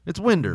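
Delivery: "winder" with an r ending, Southern; country pronunciation. Shown as a demonstration. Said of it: "doesn't say winder".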